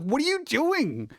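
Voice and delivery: high, drawn-out mock exasperation; exasperated. Speaker to voice assistant botching the shopping list, exasperated. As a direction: act out disbelief at a clueless helper.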